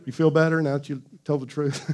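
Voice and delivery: normal talking voice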